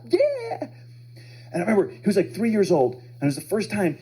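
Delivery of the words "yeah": put-on high-pitched little-kid cheer; excited toddler. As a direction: squeal it like a proud small child. Repeats the child's proud cheer.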